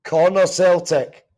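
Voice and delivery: normal talking voice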